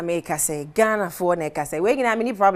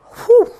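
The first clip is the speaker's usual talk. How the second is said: loud whistle-like whew; 8 dB louder than usual. Real, not acted. Reacting to low budget dressing.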